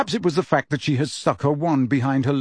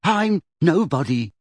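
a touch hurried, higher pitch; nervously brushing it off; 4 semitones higher than usual. A narrator's reading. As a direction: blurt it out as a quick, mild deflection. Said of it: "said Neville hurriedly".

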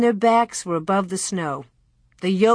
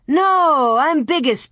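high, louder protest; objecting boy; 7 semitones higher, 4 dB louder than usual. A narrator's reading. Pierre claims the first ride from Louie, high protest. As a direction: object loudly like a pushy boy.

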